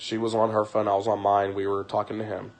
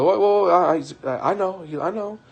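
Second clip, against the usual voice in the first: higher, stumbling over his words; flustered stammer; 8 semitones higher, 4 dB louder than usual. Retold or imitated. Pastor caught off guard by the wife, flustered. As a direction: play a pastor thrown off balance.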